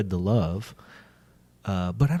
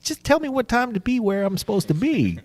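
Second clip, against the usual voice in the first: excited; 12 semitones higher than usual. Retold or imitated. Eager reply to invitation.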